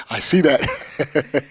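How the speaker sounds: amused, slow reply through loud laughter; real laughing. Real, not acted. Laughs at reporter's step stool joke.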